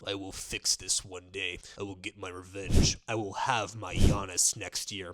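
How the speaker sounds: low scheming villain voice; scheming Pat Riley. Retold or imitated. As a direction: mutter it like a plotting villain. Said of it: "Pat Riley sitting there like this".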